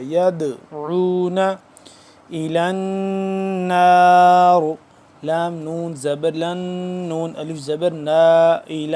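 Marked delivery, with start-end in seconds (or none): 0.0-4.6 slow melodic Quranic chant; Quran recitation. Shown as a demonstration. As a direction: recite it like tilawat with long vowels. Recites verse. (قرآن کی تلاوت کا انداز)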